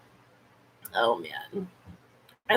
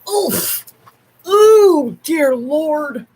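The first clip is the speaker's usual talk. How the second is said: high, loud startled ooh; exasperated surprise; startled exclaim; 5 semitones higher, 5 dB louder, much slower than usual. Real, not acted. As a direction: caught off guard by the dog. Reacting to dog barging in.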